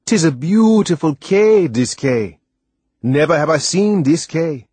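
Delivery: high, loud island lilt, full of wonder; delighted West Indian sailor. A narrator's reading. Sailor admires the island to the boy, delighted. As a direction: marvel at the island, stretching the words. Narrator voices Timothy.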